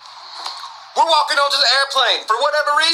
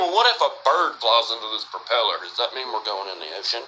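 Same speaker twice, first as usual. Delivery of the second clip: lower, softer worried inner voice; nervous friend's thoughts; 13 semitones lower, 6 dB quieter than usual. Retold or imitated. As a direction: voice his fear in a low murmur. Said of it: "He's thinking".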